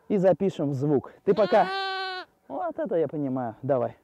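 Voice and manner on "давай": usual speaking voice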